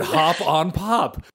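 slow, weighty mock-British reading; British accent. Podcaster mocking how profound a picture book sounds, in mock British accent. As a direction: read it slowly in a put-on British accent. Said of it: "if it's with the British accent".

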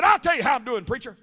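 high, loud snap-back, easing on the last word; retold outburst, punched out; imitated angry yell. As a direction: yell it as the cross patient did. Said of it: "That's the way he said it".

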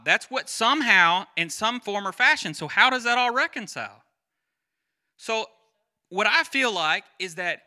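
a hint of pulpit fire, driving each question home; impassioned preaching. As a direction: preach it hard to the congregation, pitch up. Preacher builds to full force.

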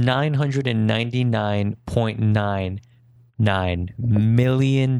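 each number drawn out slowly; slow and deliberate. Real, not acted. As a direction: spell out the huge number slowly. Stressing the size of a billion.

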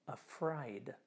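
hushed dramatic whisper. Shown as a demonstration. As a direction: let the word fall to a whisper. Dramatic whispered final word.

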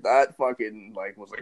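usual speaking voice

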